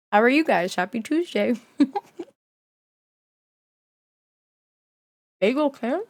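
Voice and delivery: chewing while talking; mouth full. Real, not acted. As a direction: talk through a mouthful. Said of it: "Can you hear my bagel".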